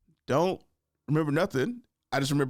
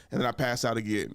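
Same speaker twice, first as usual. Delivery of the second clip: ordinary talk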